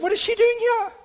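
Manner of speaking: very high-pitched indignant question; snobbish inner thought. Retold or imitated. Preacher confesses judging the poor woman, snobbishly. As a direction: pitch it way up, snootily put out. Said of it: "what a horrible person I am".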